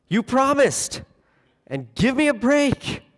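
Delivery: put-on high whiny child voice; attention-seeking child. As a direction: whine it like a needy kid. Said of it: "He is talking like a needy child".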